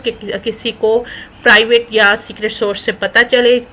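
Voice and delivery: normal talking voice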